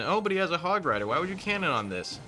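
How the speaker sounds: lightly mocking know-it-all viewer; mock viewer objection. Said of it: "you might be thinking".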